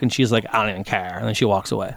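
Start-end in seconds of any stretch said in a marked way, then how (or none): 0.5-1.2 mock Valley Girl wife. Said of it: "Nick always sounds like a Valley Girl".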